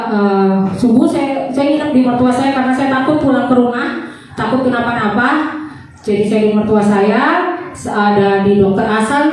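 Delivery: ordinary delivery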